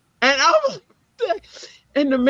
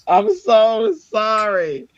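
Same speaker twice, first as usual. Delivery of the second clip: higher, slow, drawn-out sorry; retold embarrassed apology; 5 semitones higher, 4 dB louder, much slower than usual. Mom apologizes for her child, embarrassed. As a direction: stretch out a mortified apology.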